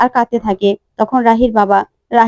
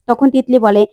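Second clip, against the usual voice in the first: ordinary delivery, nothing marked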